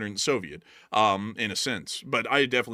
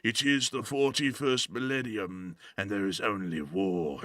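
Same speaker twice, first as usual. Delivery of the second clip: epic intro narrator; 3 semitones lower, slower than usual. Retold or imitated. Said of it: "intro where they're like".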